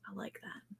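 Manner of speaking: barely audible whisper; whispered aside. Real, not acted. Host to co-host, liking holistic stuff, whispered. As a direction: whisper it under your breath.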